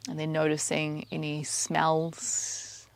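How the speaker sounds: soft, even meditation voice, spaced out with pauses; quiet, unhurried mindfulness cueing; guided meditation. Acted. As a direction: guide their attention gently through the senses. Leads a meditation.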